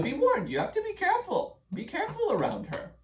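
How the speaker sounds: high, fast warning; dramatic warning. Acted. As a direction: warn them like a spooky storyteller. Narrator warns the children.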